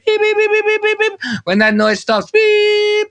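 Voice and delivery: high piercing mock beeps; imitated beeping. Driver voicing the noise in his head, high mock beeps. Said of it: "I hear this noise in my head".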